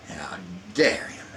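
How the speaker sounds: ordinary talk, nothing marked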